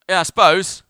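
imitated loud, high grudging answer; grudging reply. Stranded driver answers offer of help, imitated grudging reply. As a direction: mimic an ungrateful driver accepting help.

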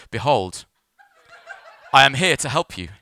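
pompous announcement, pausing after the first word; mock-grand rescuer. Imagined rescuer greets stranded drivers, mock-grand proclamation. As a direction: proclaim it like a self-styled saviour.

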